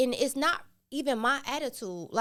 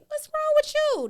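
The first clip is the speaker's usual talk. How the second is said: much higher and louder, indignant squeal; mock outrage; 7 dB louder than usual. Mom to daughter who pushed her away, high indignant squeal. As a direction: fire it back at her, shocked. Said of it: "I'm like".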